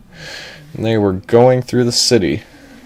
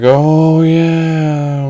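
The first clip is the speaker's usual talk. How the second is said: high, drawn-out goofy oh yeah; dawning realization; 7 semitones higher, 4 dB louder than usual. Acted. Crew conceding to their captain, drawn-out realization. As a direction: crew catching on, pitched up and stretched.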